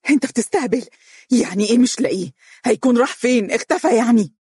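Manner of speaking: high, fast shouting at him; angry shriek of disbelief; furious yell. Acted. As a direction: scream in his face, outraged. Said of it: "صاحت بعصبية شديدة".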